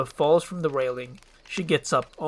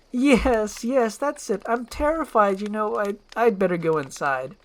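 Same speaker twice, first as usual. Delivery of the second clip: flustered excuse; 7 semitones higher, 5 dB louder than usual. Acted. Princess feigns fear to escape.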